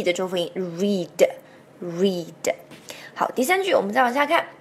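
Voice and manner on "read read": "read" with a long ee and a soft voiced d; lower, drawn-out model "read"; voiced final D. Shown as a demonstration. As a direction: say "read" slowly, twice. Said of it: "后面有个D".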